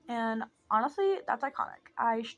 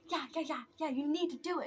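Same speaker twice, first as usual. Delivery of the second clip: higher, mock-nagging insistence; imitating annoyed sister; 4 semitones higher than usual. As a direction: mimic a sister pestering, pitched up. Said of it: "'Cause she was so annoyed. She was like".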